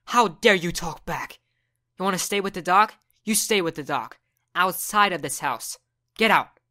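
cold rage, throw him out; angry mom. Acted.

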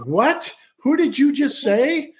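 as if the floor dropped out; jarred disbelief. Retold or imitated. Stranger hearing Bruce's name, stunned disbelief. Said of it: "this guy literally got jarred".

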